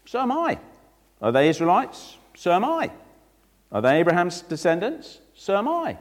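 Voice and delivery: high-pitched boastful question and answer; mock boasting. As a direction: answer each question with a high, proud retort. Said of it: "I can boast like them".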